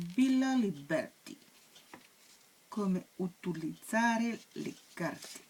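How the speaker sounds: slow, mock Italian accent reading the title. Reading the Italian guidebook aloud to viewers, in a mock Italian accent. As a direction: read it out like an Italian, slowly and proudly. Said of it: "Super l'accent italien".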